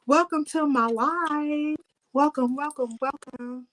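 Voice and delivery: high, slow welcoming lilt; sing-song welcome. Real, not acted. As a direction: stretch each welcome out high. Greeting live viewers.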